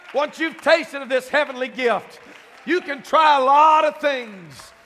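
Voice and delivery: loud, high, building preacher's cry; preaching at full force. Real, not acted. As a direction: climb to a shouting climax about God's presence.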